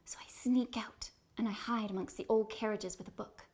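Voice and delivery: whispered, secretive sneaking; spooky ghost tour hush. Acted. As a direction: breathe it out like a secret. Actor whispering in role.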